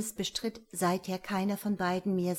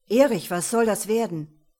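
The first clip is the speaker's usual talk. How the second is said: loud, reproachful challenge; indignant; 9 dB louder than usual. Acted. Father confronting his friend for questioning the boy, indignant. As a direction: confront him loudly.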